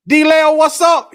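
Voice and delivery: big, slow, drawn-out hello called out; loud greeting. Real, not acted. Livestream host greeting a chat viewer, calling out loud.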